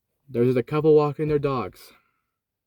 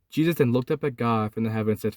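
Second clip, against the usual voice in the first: usual speaking voice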